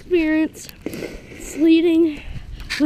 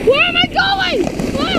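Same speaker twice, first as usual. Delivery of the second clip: much higher panicked cry; 10 semitones higher, 5 dB louder, faster than usual. Real, not acted. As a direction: squeal it in panic. Sled out of control.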